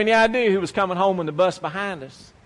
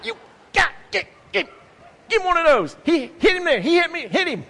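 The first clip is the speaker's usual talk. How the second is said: put-on high, loud boyish cheering on; excited cheering; 8 semitones higher, 4 dB louder than usual. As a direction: cheer your sister on from the sidelines. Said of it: "I'm up there going".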